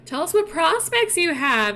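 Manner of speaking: high, sing-song acted curiosity; eager questioning. Family quizzes Mulan about the matchmaker, eager.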